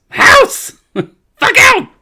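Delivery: shrieking tiny creature voice; high, loud squeaky yelling; pixie. Acted. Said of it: "It goes".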